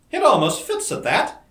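high, loud, amused exclamation without real laughter; laughing exclamation. A narrator's reading. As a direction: exclaim it with mild mock laughter. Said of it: "exclaimed Dorothy, laughing".

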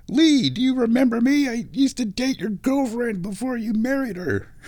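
high-pitched comic plea; mock pleading. Host pretending to beg an old acquaintance for discounts, high mock plea. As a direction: call out in a put-on high voice.

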